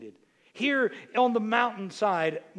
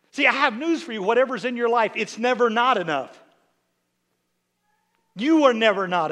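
louder, rising preacher cry; preaching climax; 2 semitones higher, 6 dB louder than usual. Acted. Preacher assuring his church they are enough, rising to a shout. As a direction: build to the shout.

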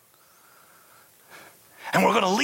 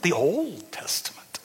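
whispered aside. Shown as a demonstration. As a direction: whisper "the Old Testament" under your breath.

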